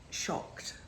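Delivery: stunned, barely a breath on "shocked"; a hushed, voiceless "shocked"; whispered shocked. Real, not acted. Stunned by mum's revelation.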